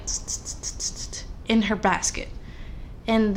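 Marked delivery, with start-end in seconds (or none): 0.1-1.1 soft unvoiced tss clicks; quiet tss tss sound effect; hissing noise. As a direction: imitate the faint rustle in the basket. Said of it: "she hears this, like".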